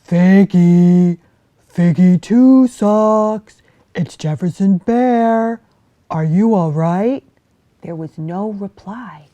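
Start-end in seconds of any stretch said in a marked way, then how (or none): 0.1-7.2 loud, slow calling across to her den; worried bear calling at the fox's den. Acted. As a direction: call out for a friend. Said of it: "and called".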